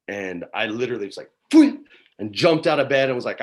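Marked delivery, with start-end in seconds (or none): 1.5-1.8 a loud high "Phew" of relief; relieved phew. Retold or imitated. Father hears daughter can't sleep, loud relieved phew. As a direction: blow out sudden relief.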